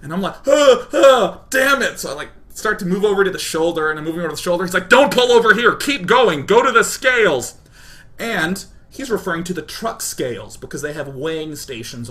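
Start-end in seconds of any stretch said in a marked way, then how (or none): 0.5-2.0 high, loud panicked yelps; flustered panic. Retold or imitated. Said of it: "I'm just completely flustered". 4.9-7.6 high, loud barked police orders; annoyed megaphone cop. Retold or imitated. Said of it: "a really annoyed police officer on a megaphone".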